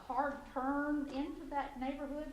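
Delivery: usual speaking voice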